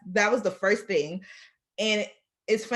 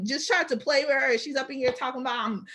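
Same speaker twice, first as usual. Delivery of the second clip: normal talking voice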